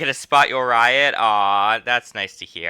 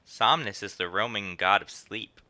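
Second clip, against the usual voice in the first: ordinary talk, nothing marked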